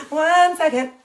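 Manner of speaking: loud, high laughing call; laughing shout. Real, not acted. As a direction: holler it while laughing. Repeated louder, laughing.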